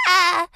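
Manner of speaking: cartoon kid's sliding ah. Shown as a demonstration. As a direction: slide the ah around. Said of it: "a little slide thing".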